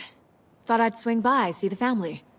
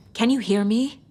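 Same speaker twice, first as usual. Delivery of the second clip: calling out louder to wake him; 3 semitones higher, 5 dB louder than usual. Acted. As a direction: raise the voice, call to the old man. Calling to unresponsive Odin.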